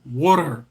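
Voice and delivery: "Water" said loud and firm, with a stressed first syllable and an r-coloured ending like "wooter"; pronouncing water. Shown as a demonstration. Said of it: "H two O is pronounced water".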